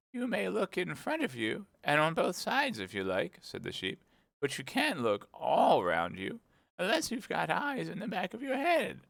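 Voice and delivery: put-on a fussy old sheep at her counter. A narrator's reading. The sheep asking Alice what she wants, old sheep voice.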